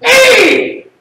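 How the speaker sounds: high, loud angry outburst; hammed-up angry Italian cry; mad. Shown as a demonstration. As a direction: yell it as if you're mad. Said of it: "say you're mad".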